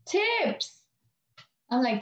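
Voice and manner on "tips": spaced-out customer. Retold or imitated. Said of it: "she finally".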